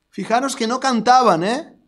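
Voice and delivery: ordinary delivery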